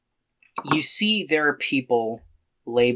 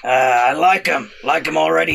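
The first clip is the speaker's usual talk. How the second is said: loud, rough orc approval; gravelly half-orc; 4 semitones higher, 6 dB louder than usual. Acted. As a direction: growl it with hearty approval. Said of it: "sometimes the gravelly voice takes a lot".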